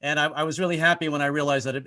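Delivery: ordinary talk, nothing marked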